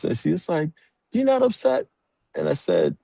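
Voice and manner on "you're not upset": very high-pitched mock disbelief; imitated astonishment in a high voice; shocked. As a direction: squeak the question, imitating a shocked mom. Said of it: "she was sort of shocked".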